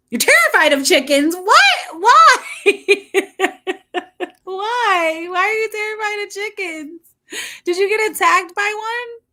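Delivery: high, loud laughing disbelief at chickens; real incredulous squeals between laughs; can't believe she's scared of chickens, laughing high; shocked disbelief. Real, not acted.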